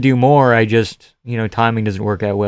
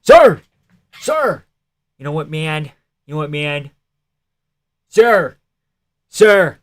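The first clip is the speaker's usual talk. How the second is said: put-on Philly accent; 4 semitones higher, slower than usual. Streamer trying a Philly accent on chat. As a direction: bark "Sir!" like a Philly cop. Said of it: "how do I do a Philly accent?".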